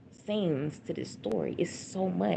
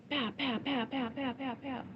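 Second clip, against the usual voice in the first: quick higher-pitched "pow" volley; rapid string of pows, not shouted; imitated gunshots; 7 semitones higher, 4 dB quieter than usual. Mother imitating the shots she heard over the phone, imitated gunshots.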